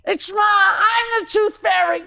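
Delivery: high, loud shouting mother. Retold or imitated. Mother caught as the tooth fairy, shouting high and loud. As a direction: yell it out like a frantic mom caught in the act. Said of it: "She's like".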